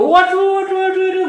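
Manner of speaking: high, startled yelp of a falling soldier; mock panicked cry. Fallen enemy soldier yelps, high panicked cry. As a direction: cry out like a man knocked off his feet. Struck Amalekite soldier falls.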